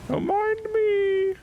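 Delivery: high, slow, sing-song politeness; high drawn-out mock-innocent voice; mock polite. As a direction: tiptoe past with fake innocence. Sneaking past dragon.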